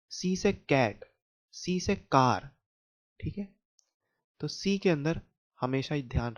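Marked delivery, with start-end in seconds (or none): 0.1-1.0 सी से कैट with a hard k, pitched up; c as in cat. Shown as a demonstration. Teacher correcting students, stressing the hard k. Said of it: "नहीं".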